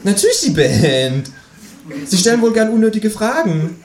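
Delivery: high, exasperated kangaroo; high-pitched irritated character voice; impatient kangaroo. Acted. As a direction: retort it high and testily.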